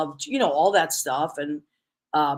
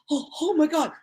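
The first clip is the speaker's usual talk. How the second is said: overwhelmed; 8 semitones higher than usual. Retold or imitated. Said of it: "tears started coming out of her eyes".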